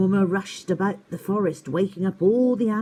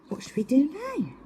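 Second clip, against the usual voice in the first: high, quick owlet voice; a small owl, eager; young owl voice; 10 semitones higher, faster than usual. Acted. Twit voice.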